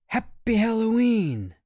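high, loud sing-song greeting; cheerful cry. Acted. Kids greeting their parents at breakfast, thrilled. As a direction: burst out with holiday cheer.

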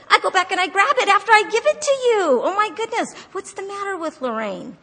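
high, loud outburst of frustration with yourself; exasperated self-reproach. Acted. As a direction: cry out in exasperation, then come down at the end.

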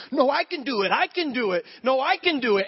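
put-on high, loud insistent kid voice; a four-year-old who suddenly wants to do it himself; imitating a stubborn little boy; defiant toddler. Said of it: "he goes".

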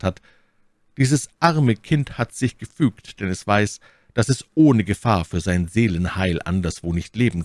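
reading the cousin's letter. Acted. Aramis reads aloud his cousin's letter to friends, lightly voiced. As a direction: read it as the cousin writing.

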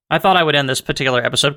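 normal talking voice

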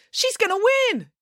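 put-on very high girlish cheer; excited cry. A narrator's reading. April sees her cockroach winning, high excited cry. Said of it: "cried April".

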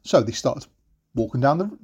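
plain reading voice

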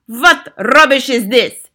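slow, loud, imperious shout; Grand High Witch. A narrator's reading. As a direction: shout it with drawn-out scorn. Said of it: "shouted the Grand High Witch".

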